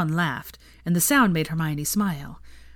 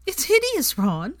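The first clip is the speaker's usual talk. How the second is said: high wail, mildly tearful; choking on sob; 11 semitones higher, 3 dB louder, slower than usual. A narrator's reading. Hermione choking up over Ron's jumper, high wail. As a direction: wail it high, a touch choked up. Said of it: "choking on another sob".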